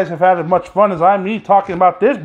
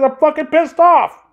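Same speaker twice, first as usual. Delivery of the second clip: real angry outburst; 8 semitones higher than usual. Real, not acted. Ranting about politicians, he explodes in a real angry shout. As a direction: blow up at the end of the line. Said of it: "I get fucking pissed off".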